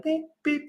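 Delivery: high, clipped beep beep like a monitor. Retold or imitated. Patient imitating the hospital monitor beeping. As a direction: mimic the machine's beeping.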